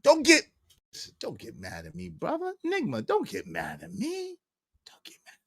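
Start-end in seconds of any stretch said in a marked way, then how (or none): 1.2-5.3 dropping quiet. Real, not acted. Quieting down after a rant.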